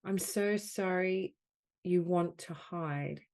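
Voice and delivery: projected, emphatic apology phrases with long pauses; guided healing affirmation. Acted. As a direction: offer each phrase firmly to be felt.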